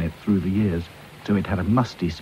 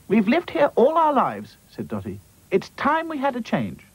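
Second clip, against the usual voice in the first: Dotty's voice. Acted. Dotty speaks.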